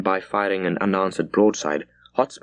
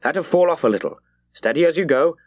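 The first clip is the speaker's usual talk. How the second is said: shouted order; 7 semitones higher than usual. A narrator's reading. Captain giving steering orders to the wheel, mild shouted command. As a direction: call it over the wind. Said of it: "he shouted to the wheel".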